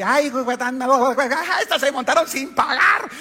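high, loud mocking laugh-talk; laughing mockery. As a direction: cackle at them riding free. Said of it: "él empezó a reírse". (burla entre risas, aguda y fuerte)

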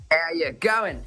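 casual Australian greeting; light Aussie drawl; Aussie accent. Pretend. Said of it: "fantastic Aussie accent".

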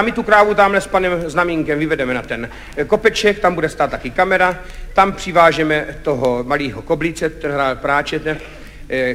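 low, subdued director giving instructions; Kachňa. Retold or imitated. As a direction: lay out the plan low and unruffled. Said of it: "přišel režisér Kachňa a povídá". (hluboký, klidný hlas režiséra)

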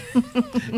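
quick high giggle; a bright burst of a laugh; short laugh. Real, not acted. Laughs at own pronunciation worry.

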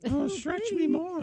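high, slow mock-sultry plea; hammed-up seductive falsetto; affected voice. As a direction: coo it out slowly like a vamp. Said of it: "Did you affect a voice?".

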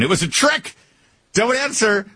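high-pitched mock warning; mock alarm. Host playfully warns witness of a trap, mock alarm. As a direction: cry it out as a pretend panicked warning.

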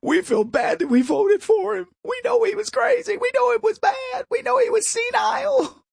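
high, shouted mock confession; mocking Democrats. Host mocks Democrats confessing guilt, in a high mock shout. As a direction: squeal it out like a guilty voter. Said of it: "they don't wanna tell you".

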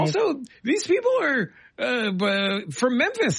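high-pitched comic exasperation; exasperated protest. Acted. Host continues his comic protest.

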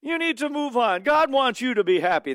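high, sing-song mock of a soothing pastor. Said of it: "the pastor over and over again said".

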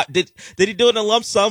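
high, fast question through laughter; real amused laugh running into the words. Real, not acted. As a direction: crack up while asking, pitch shooting up. Co-host laughs at the huge fine.

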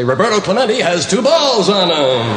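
a hint of play-by-play commentary; Kurt Gowdy. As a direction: call it like a ballgame announcer. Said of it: "okay for Kurt Gowdy to say".

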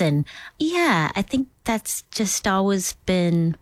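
normal talking voice

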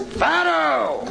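high, drawn-out hail across a room; shout. Acted. Old pal hails Farrow across the inn, shouting. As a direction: call out the name high and long. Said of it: "and he shouts".

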